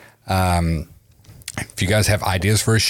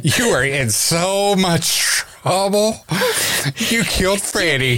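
high, slow mock scolding; scolding mom; 10 semitones higher, slower than usual. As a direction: play the outraged mom, laughing. Mock parent scolding, laughing.

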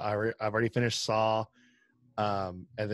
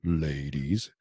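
much lower, playful ladies; low goofy greeting; 8 semitones lower than usual. Acted. Greeting returning co-host.

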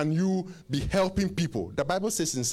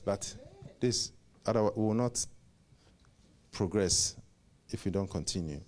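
ordinary talk

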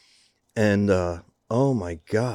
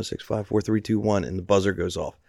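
ordinary delivery

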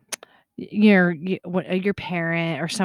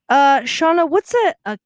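higher, louder imitation of a confused mom; puzzled mom; 6 semitones higher, 8 dB louder than usual. Mom phones her daughter about a beer, puzzled. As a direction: play mom groping for a word.

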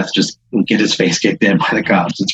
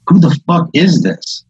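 indignant demand; 8 semitones higher than usual. Acted. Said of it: "Darlene's like".

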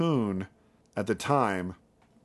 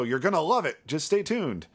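higher-pitched, upbeat promo voice; mock cheerful announcer; happy-spin TV pitch; 7 semitones higher than usual. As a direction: sell it like a TV plug. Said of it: "put a happy spin on anything".

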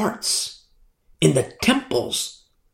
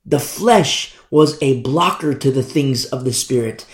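ordinary talk

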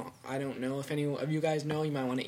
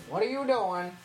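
calling out to turtle; 6 semitones higher, 3 dB louder than usual. Real, not acted.

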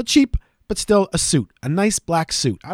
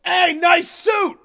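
high, drawn-out mock heckle from the crowd; heckler's call; 12 semitones higher, much slower than usual. Big Jim heckling the announcer from the crowd, drawn-out mock shout. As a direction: imitate a far-off heckler, slow and high. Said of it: "Big Jim from the crowd go".

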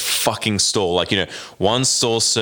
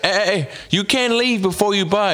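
mock pushy vendor; 8 semitones higher than usual. Vendor stopping him leaving.

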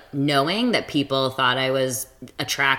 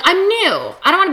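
high squeaky voice; 13 semitones higher, 3 dB louder, much faster than usual. Retold or imitated. Mimicking naive dater.

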